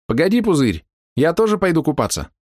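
high call from another room. Acted. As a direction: shout it across the house. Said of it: "прокричала из своей комнаты Ленка".

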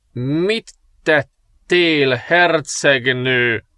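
very slow, horrified priest voice; frightened question. Acted. Priest finds the bodies and asks the princess, horrified. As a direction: ask it aghast, barely believing. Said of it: "kérdezte rémülten".

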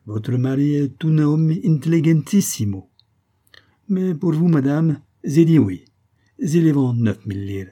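put-on high, loud Italian-accented effusion; theatrical merchant giving in; Italian shopkeeper, flattering. A narrator's reading. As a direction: gush compliments as an Italian vendor.

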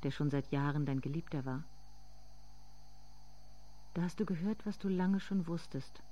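soft, intimate narrating voice; softly spoken inner monologue; hushed story narration. A narrator's reading.

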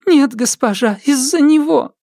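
very high, panicky ghost woman; sobbing ghost voice. Acted. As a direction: shift the blame in fear. Frightened ghost blames another.

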